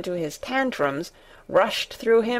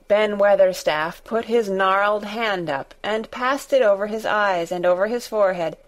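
ordinary delivery

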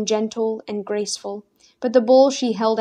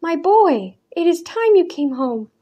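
put-on high, a little louder, fond old woman; a frail grandmother welcoming her boy home; 8 semitones higher, 4 dB louder than usual. A narrator's reading. Grandmother greets returning grandson.

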